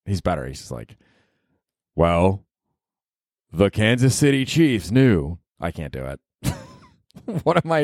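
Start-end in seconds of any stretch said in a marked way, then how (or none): 2.0-5.4 slow, hammed-up movie-trailer narrator; a lightly voiced documentary narrator; documentary voiceover. Podcaster imitating the HBO voiceover guy, slow and grave. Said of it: "the voiceover guy in the H B O show is great".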